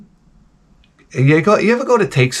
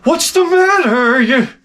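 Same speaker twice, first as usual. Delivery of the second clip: high slow nagging wife voice, much higher; mimicking wife; 14 semitones higher, slower than usual. Husband mimics his wife asking what's wrong in a high slow voice. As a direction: stretch it out like a prying wife. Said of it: "your wife comes out ... she's like".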